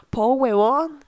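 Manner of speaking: lightly voiced Chilean accent from a gringo; a gringo trying Chilean slang. Mimics Michael Cera speaking Chilean slang, a light Chilean accent. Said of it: "escuchar hablar a Michael Cera con acento chileno".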